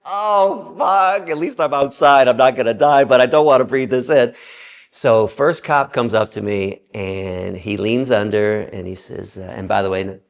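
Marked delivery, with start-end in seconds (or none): 0.0-4.3 high, loud exasperated outburst; hammed-up alarmed complaint; loud dismay. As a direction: wail it in mock panic, easing down in pitch. Exhaust blowing into his face.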